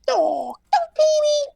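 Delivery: tiny squeaky creature voice, very high and slow; squeaky Jawa. Retold or imitated. Jawas squealing at the captured droid, tiny squeak. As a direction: squeal it like a little desert scavenger. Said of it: "And the Jawas go".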